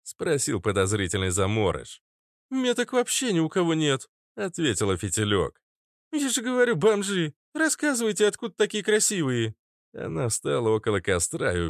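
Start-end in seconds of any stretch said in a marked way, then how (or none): 2.5-4.1 much higher-pitched character voice; Фитилёк's character voice. Acted. Narrator voices Фитилёк answering. 6.1-9.5 high-pitched, sassy demand; challenging character voice. Acted. Narrator voices Фитилёк challenging the strangers.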